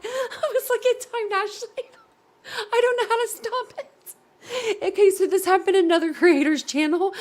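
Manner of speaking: helpless, squeaky-high real laughing fit; laughing to tears. Real, not acted. Streamer can't stop the bot blocking people, laughing to tears. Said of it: "I'm laughing so hard, I'm crying".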